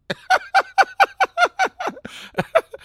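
high, loud, wild laugh; shrieking laugh. Real, not acted. Laughing at the landlord's dead-hairdresser story in a high shriek. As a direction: cackle out loud in disbelief.

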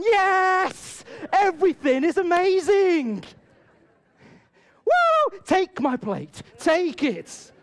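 hammed-up delight ending in a laugh; over-the-top enthusiasm. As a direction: gush wildly to please the waitress. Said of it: "we didn't really do it that, that big".

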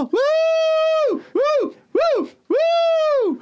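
string of very high whoops; excited woohoo. Retold or imitated. Acting out delight at some nice pillows, high excited whoops. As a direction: squeal "woo" over and over. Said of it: "der er meget woohoo".

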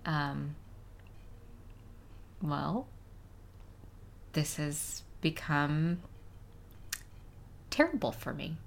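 soft slow mock despair; hammed-up quiet heartbreak; mock heartbroken. Pretend devastated, no gift.

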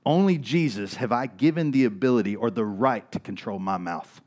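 ordinary talk

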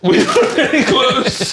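high, slow mocking echo of his friend; a high, drawn-out mock repeat of the line. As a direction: parrot him back in a silly high voice.

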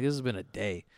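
ordinary talk

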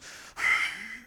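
high breathy exaggerated huff; dramatic sigh. Grandpa imitating granddaughter's dramatic huff. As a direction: huff theatrically like a wronged child. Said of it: "Harper goes".

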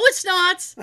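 high, loud imitation of mom denying it; mock denial. Mother denying she is mean, hammed-up insistence. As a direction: protest it with the pitch jumping up.

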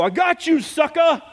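high gloating yell; triumphant taunt. Retold or imitated. Pastor taunting the caught driver with his license plate, high gloating yell. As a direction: crow it in his face.

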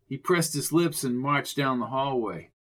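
usual speaking voice

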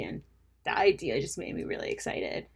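normal talking voice

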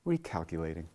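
low, soft satnav voice; quiet, composed GPS reply; calm GPS lady. Acted. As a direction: drop to an unruffled navigation announcement. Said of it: "She just says".

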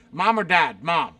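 ordinary talk, nothing marked

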